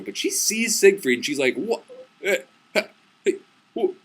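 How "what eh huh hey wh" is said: high, broken half-words; stunned spluttering. Retold or imitated. Bride sees her husband marrying another, comic stammering shock. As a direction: sputter in mock disbelief.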